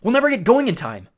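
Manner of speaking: high, loud worried yell. A narrator's reading. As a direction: shout it over the chaos. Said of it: "Annabeth yelled".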